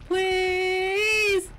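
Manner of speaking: high loud pleading shout; desperate yell. Acted.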